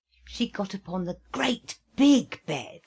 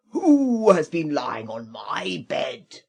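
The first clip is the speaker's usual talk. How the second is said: loud, slow booming bear; great big voice; 3 semitones lower, 9 dB louder, slower than usual. Acted. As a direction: boom it out like a huge bear. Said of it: "he cried out in his great big voice".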